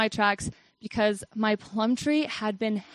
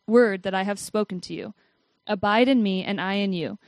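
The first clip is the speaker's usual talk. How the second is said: louder, measured Bible reading; scripture reading; 5 dB louder than usual. Acted. Reading John fifteen to her listeners, a louder scripture read. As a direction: read the verses out to the congregation.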